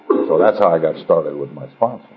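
ordinary delivery

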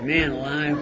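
ordinary talk

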